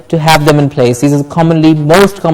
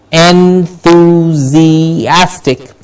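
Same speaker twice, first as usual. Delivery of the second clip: "Enthusiastic" extremely slowly, vowels held; long drawn-out en-thuu-zii-AS-tic; much slower than usual. Shown as a demonstration. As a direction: say "Enthusiastic" pulled apart syllable by syllable. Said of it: "say the word a little quicker now".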